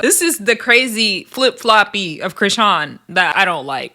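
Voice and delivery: ordinary talk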